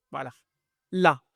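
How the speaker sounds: ordinary talk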